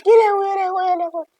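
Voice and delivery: high, loud panicked cries; panicked shriek. Real, not acted. Gamer realises he has no reflection in the mirror, panicked shriek. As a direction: shriek in real alarm.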